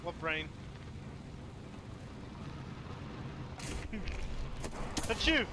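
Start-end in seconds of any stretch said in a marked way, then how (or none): 0.5-5.0 laughing. Real, not acted. Laughing at brain joke. 5.1-5.5 loud sneeze. Real, not acted. Sneezes loudly.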